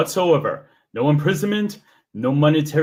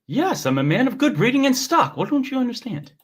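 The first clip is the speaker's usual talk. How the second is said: mock haughty gentleman; 8 semitones higher than usual. Acting as Joran.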